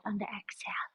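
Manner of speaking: whispered breath cue; whispering. Real, not acted. Cue during side stretch.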